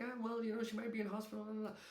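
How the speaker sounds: high, soft hemming and hawing; mock yourself making a feeble excuse; reluctant excuse. Arguing with inner voice about calling, high soft reluctant excuse.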